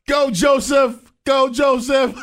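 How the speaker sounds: high, loud, slow cheer; cheering shout. Real, not acted. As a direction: yell it like a fan.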